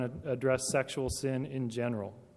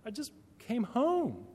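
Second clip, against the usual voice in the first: put-on higher, innocent kid protesting; kid's voice; 8 semitones higher than usual. Son walks in and answers his suspicious mom, higher. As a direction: sound like a wronged teenager. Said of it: "he just says".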